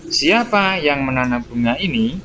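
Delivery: SIAPA lifted, INI rising at the end; question intonation. Shown as a demonstration. Teacher repeats the example question for pupils, question intonation with rise on INI. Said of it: "kalimat pertanyaan kan ada intonasinya".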